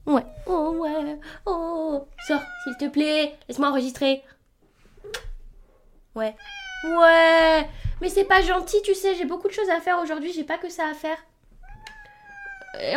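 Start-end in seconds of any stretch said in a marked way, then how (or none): 0.1-11.2 high, cooing voice for the cat. Real, not acted. Talking to her cat.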